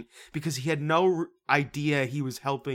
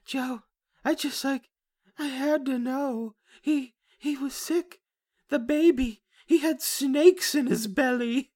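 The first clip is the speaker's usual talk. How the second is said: much higher, slow mock-fragile woman voice; mocking Emily; 12 semitones higher than usual. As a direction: play her as dazed and fragile, pitched way up. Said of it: "and Emily's going".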